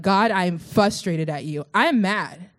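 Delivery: usual speaking voice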